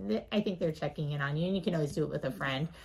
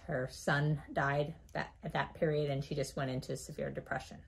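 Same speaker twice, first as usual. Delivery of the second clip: ordinary talk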